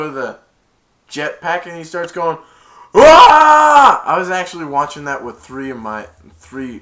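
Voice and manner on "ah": long, loud high yell; full-voiced mock scream; imitated scream. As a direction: scream like someone on a runaway jetpack.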